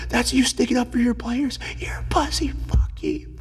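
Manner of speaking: high, strained mock yell; retold swearing rant. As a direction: re-enact losing it at the coach. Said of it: "I'm just swearing at this coach".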